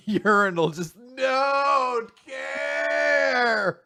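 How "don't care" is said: long drawn-out, high holler; Jay Cutler. Acted. Quarterback at the urinal, hollering dismissively. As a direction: stretch each word into a mock bellow. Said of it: "just Jay Cutler at the urin".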